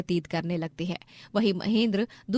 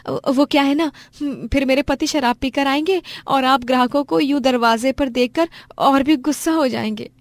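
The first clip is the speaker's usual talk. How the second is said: higher, louder pleading explanation; anxious wife; 5 semitones higher, 7 dB louder than usual. Acted. Wife warns customers about her drunk husband, anxious and raised.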